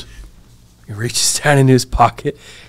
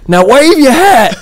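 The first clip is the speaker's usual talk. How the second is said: high, loud, drawn-out command through laughter; imitated call out; 8 dB louder than usual. Officer calling from car window, mock shout.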